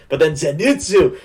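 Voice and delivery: ordinary talk, nothing marked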